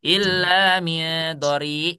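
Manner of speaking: "Illa min dori" drawn out slowly; teacher reciting the verse as a model; slow recitation. Shown as a demonstration. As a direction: recite it deliberately. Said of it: "Atau yang tadi mana?".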